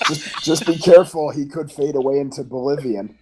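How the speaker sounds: lightly voiced boxer impression; a hint of the famous boxer; Mike Tyson. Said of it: "I don't ever do my Mike Tyson".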